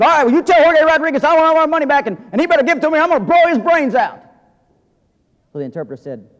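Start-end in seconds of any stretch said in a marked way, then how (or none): 0.0-4.2 high, loud, fast lawman demand; Texas Ranger shout. Retold or imitated. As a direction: bark it like a tough ranger storming in. Ranger threatens through interpreter.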